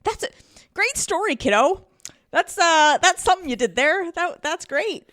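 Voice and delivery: high, hammed-up dad trying to sound upbeat; corny dad voice. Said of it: "Dad being such a dad".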